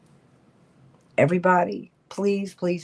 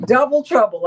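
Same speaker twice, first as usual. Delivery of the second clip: louder, higher delighted call; delighted exclamation; 6 semitones higher, 9 dB louder than usual. Real, not acted. Host delighted by two grandmothers, gleeful call. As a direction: toss it out with glee.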